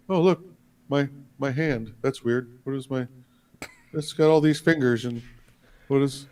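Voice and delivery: mock Biden muttering at his own fingers; confused Biden. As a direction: lose the thread, puzzled and hushed. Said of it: "here's my impression of Joe Biden".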